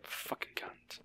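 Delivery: muttered under the breath; whispered insult. Real, not acted. Gamer whispering an insult at a needy NPC. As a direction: whisper it with contempt.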